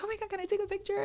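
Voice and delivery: very high-pitched fan squeal; starstruck fan. Retold or imitated. Fan asking the chef for a photo, starstruck. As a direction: imitate a fan pleading for a picture.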